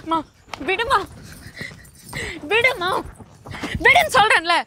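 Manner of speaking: high-pitched protest to mother; agitated plea growing forceful; upset demand. Acted. Daughter demands mother let go.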